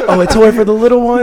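laughing cashier upsell; cheery clerk offering extras; drive thru speaker. Pretend.

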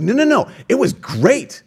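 high, slow, delighted protest; older brother cheerfully insisting it was fine; enthusiastic reassurance. Retold or imitated. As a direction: brother brushing it off gladly. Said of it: "He's like".

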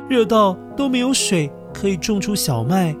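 ordinary delivery, nothing marked

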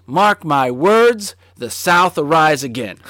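slow, loud Southern warning; southern guy. Retold or imitated. Retelling the Alabama kid who wagged a finger, slow and loud. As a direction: finger in my face, drawn-out threat. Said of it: "And he goes".

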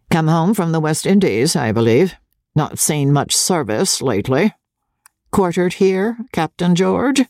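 haughty General's questioning; lofty, superior interrogation. A narrator's reading. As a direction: question him with lofty condescension. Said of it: "the General went on haughtily".

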